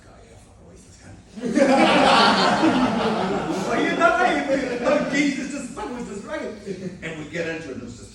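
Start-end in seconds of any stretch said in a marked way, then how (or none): 0.1-1.2 hushed whisper to himself; cocky whispered aside; whispered thought. Retold or imitated. Retold inner thought on seeing him. 1.2-3.6 big open belly laugh; hearty real laugh; loud laugh. Real, not acted. Laughs after the story beat.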